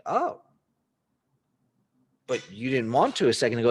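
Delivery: a caught-off-guard seller, taken aback; startled Oh, then fast teasing reproach; surprised seller. Acted. Seller surprised at the full-price offer.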